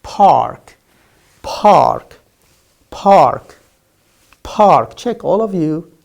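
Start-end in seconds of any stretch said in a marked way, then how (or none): 0.0-5.0 "park" with a strongly puffed P; loud, separate repeats of "park", air on the P; clear P sound. Shown as a demonstration. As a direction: model "park" with aspirated P, not B. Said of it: "It's not bark".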